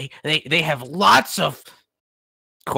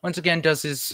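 ordinary delivery

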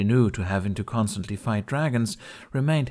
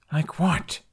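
hissed; 7 semitones higher than usual. Acted. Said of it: "Hiccup hissed".

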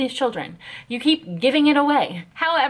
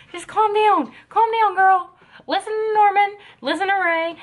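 put-on much higher, cooing to a panicked girl; high coaxing calm-down; 8 semitones higher, 3 dB louder than usual. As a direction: coax her down in a high sing-song. Soothing panicking Emma, high.

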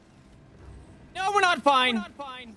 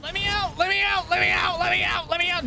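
high, desperate yell; 9 semitones higher than usual. Real, not acted. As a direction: yell to be let out. Trapped by enemies, yelling.

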